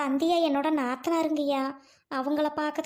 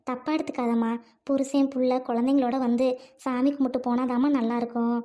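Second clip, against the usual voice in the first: usual speaking voice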